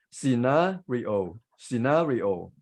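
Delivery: "scenario" as si-NAH-ri-o, stretched NAH; slow, split si-NAH, ri-o, then joined; British pronunciation. Shown as a demonstration. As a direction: stress a long "ah" in the second syllable of "scenario". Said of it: "Stress is on the second syllable".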